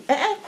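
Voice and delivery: "Eh, eh" on a high tone meaning yes; tonal eh eh. Shown as a demonstration. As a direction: say "Eh, eh" high and short.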